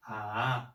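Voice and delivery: bend each ah down, then up; turning third tone. Shown as a demonstration. Teacher demonstrating the third tone's turn on ah. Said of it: "turn the tone".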